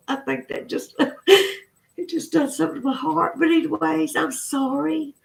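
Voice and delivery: sobbing while apologizing to viewers; crying. Real, not acted. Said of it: "I'm just crying about reliving that moment".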